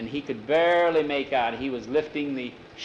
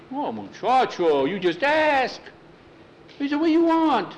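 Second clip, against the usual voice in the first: eager offer; 11 semitones higher than usual. Acted. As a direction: offer big, pitch leaping up. Miguel eagerly offers a favor.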